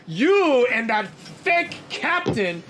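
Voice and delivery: high, loud, slow furious tirade; a warrior throws off his fur and rages at a rival; angry outburst. Acted. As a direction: build from cold scorn to a roaring accusation. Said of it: "You sound upset".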